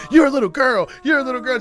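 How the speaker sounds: high, loud sung taunt; mocking singsong. Comic shouting a taunt back at the girl, singsong. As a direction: chant it back to tease. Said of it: "I'm like".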